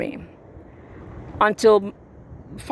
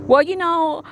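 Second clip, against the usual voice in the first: higher-pitched mimicry of a man's excuses; mock-defensive, pitched up; mocking imitation; 7 semitones higher than usual. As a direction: mimic his blustering start in a high voice.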